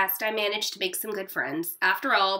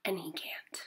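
whispered aside; 5 semitones lower, 15 dB quieter than usual. Real, not acted. Teacher's quiet aside.

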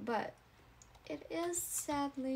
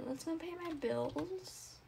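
normal talking voice

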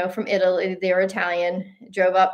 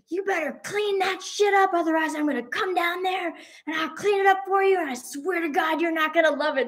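retold angry threat; 10 semitones higher than usual. Husband threatening a litterer from the balcony, mock-angry. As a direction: imitate an irate husband without full volume. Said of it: "tattooed guy yelling at him".

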